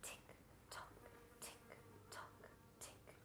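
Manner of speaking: whispered, evenly spaced clock ticks; tiny, measured whispered beats; ticking clock. Acted. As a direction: whisper each tick like a clock.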